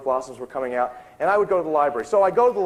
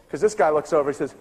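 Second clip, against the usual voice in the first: usual speaking voice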